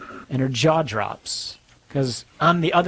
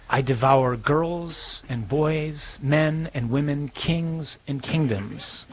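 very slow, lightly voiced lion; lion Aslan speaking; much slower than usual. Preacher voicing Aslan to the frightened girl, very slow. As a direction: draw each phrase out slowly as the lion, barely changing the voice.

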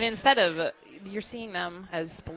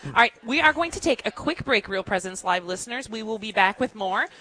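lifted, energetic throw to the break; punchy radio sign-off to a break; radio host break announcement; 4 semitones higher, 7 dB louder than usual. Real, not acted. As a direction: send listeners to the break, brisk and upbeat.

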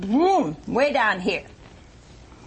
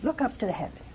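hushed whisper; 8 dB quieter than usual. Acted. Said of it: "and he says".

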